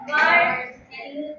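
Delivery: "garden" with a loud, stretched GAR; stressed syllable shown. Shown as a demonstration. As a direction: hit the first syllable of "garden" hard. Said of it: "nag-stress ka sa".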